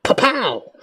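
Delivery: punch sounds. Retold or imitated.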